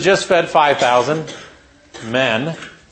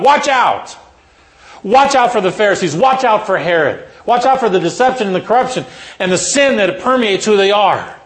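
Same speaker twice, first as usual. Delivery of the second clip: yelling it out; 5 semitones higher than usual. Retold or imitated. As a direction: yell the warning at the disciples. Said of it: "he just yells it out".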